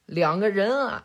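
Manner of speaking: usual speaking voice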